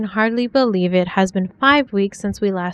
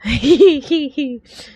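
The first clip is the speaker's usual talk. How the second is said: fond laugh; 5 semitones higher, 5 dB louder than usual. Real, not acted. Laughs after French quote.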